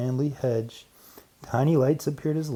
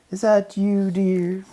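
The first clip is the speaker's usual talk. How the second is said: higher, louder call from the kitchen; calling Missus Apple; 8 semitones higher, 7 dB louder than usual. Acted. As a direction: call out sweetly to her husband. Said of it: "called Missus Apple".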